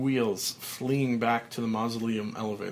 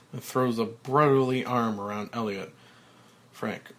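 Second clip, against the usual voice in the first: ordinary delivery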